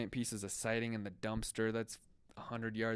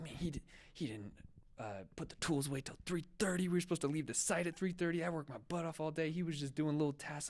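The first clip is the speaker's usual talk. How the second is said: higher, quicker griping rant; typed-out rant read with mild heat; 7 semitones higher than usual. Retold or imitated. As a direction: pour out the complaints fast. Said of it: "I was just, like, ranting".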